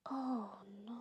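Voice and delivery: very soft, sympathetic oh no; hushed dismay. Real, not acted. Reader feeling for the younger brother, hushed dismay. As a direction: let it out small and soft.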